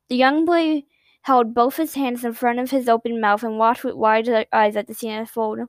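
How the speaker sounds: plain reading voice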